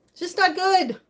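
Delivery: sudden loud repeat after the whisper; emphatic. Real, not acted.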